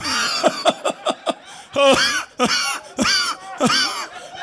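high, loud mock pastor laugh; pastor's high cackle. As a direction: cackle like a wild preacher. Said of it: "he can't laugh this laugh".

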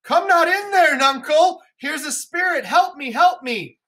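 frightened fool. Acted. Fool fleeing the hovel in fright, high and panicked. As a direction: scream for help at the spirit. Teacher voices terrified fool.

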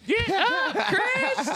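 high, laughing shout of a name; giggling wake-up yell; laughing call. Real, not acted. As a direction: call him up through laughter.

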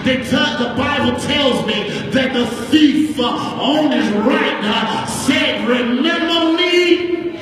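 sung, tuneful preacher's chant over the organ; rising sing-song sermon cadence, building the crowd; whooped preaching. Acted.

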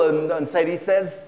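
ordinary delivery

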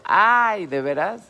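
sudden high, loud "ay" of realization; real surprise. Real, not acted. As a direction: catch yourself out, startled, then laugh it off. Host realizes audio is too quiet.

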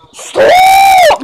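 very high, loud drawn-out scream; screamed stop. Pretend. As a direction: scream it in protest. Toy screams in protest.

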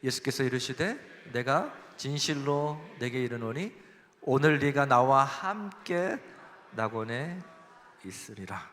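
slow, measured reading of a verse together; scripture reading aloud. Shown as a demonstration. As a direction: read the verse slowly with the congregation. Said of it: "같이 읽어보겠습니다".

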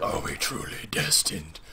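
slow, hushed whisper; imitated solemn whisper; whispered Charles Xavier. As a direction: whisper the question slowly and gravely. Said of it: "When Charles Xavier's like".

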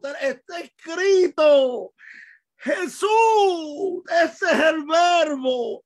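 high, loud, stretched-out cries; exasperated preaching shout. Real, not acted. Preacher to slow congregation, impassioned exasperated shout. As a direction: shout it out with rising fervor, drawing out the words.

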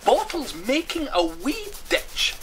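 high, slow, loud grumble; sulky high-pitched character voice; grudging. Acted. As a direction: sneer at it grudgingly. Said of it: "said Hamish, grudgingly".

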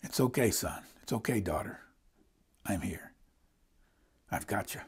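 very soft, low, comforting murmur; soothing parent. Acted. Loving parent to a crying, worried child, soothing. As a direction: hush a crying child with gentle reassurance. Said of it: "loving mother will take a baby that's crying and upset".

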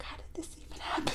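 fast hushed whisper of disbelief; unvoiced quick whisper, amazed. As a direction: whisper it, baffled. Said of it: "I was like".

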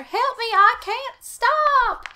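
high, loud, slow wailing plea; hammed-up electrocution victim; panicked cry. A narrator's reading. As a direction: squeal it out, stretching the last word. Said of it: "he managed to cry".

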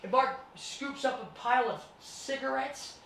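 usual speaking voice

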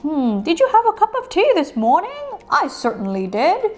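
1 put-on voice. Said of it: "I'm using that voice".

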